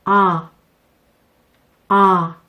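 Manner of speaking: "아" held with the jaw dropped from an 어 mouth; an open aw sound between 어 and 아; teacher models the jaw-dropped vowel; demo open aw vowel. Shown as a demonstration. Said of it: "아 소리를 내시면 됩니다".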